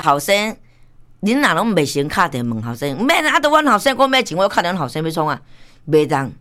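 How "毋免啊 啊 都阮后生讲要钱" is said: a quick, higher retort, fast; mock father. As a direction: brush it off as the father. Voicing the duped father. (快速模仿父亲反驳)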